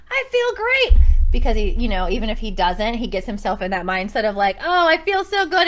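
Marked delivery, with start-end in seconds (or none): 0.1-1.0 high, loud fake-bright "I feel great"; faked cheer. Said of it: "and he fakes it". 4.6-5.7 higher, mock-cheery self-talk; faked cheer. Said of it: "fakes it until he makes it".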